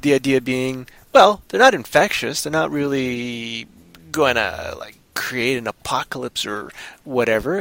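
ordinary talk

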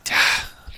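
short, voiceless huff of breath; exasperated sigh. Real, not acted. Co-host insists she lives on the edge, exasperated sigh. Exasperated at co-host's geography answer.

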